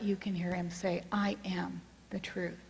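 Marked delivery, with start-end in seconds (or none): 1.1-2.6 soft, measured inner assurance; hushed, steady reassurance; quiet divine voice. Retold or imitated. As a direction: say it gently, as God heard in the heart. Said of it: "in the quietness of your heart".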